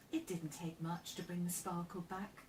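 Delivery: ordinary delivery, nothing marked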